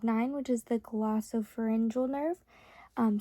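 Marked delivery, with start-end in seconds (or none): none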